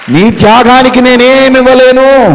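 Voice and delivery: high, loud cry of devotion; disciples' fervent vow. Acted. Disciples vowing to their Lord, high and loud. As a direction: cry out that you have nothing to give.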